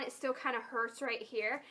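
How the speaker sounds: ordinary talk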